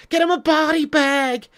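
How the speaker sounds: high, strained put-on yell; hammed-up film heckler. Streamer quoting Karate Kid to a viewer, high hammed-up taunt. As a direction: crow it like a rowdy cheering henchman.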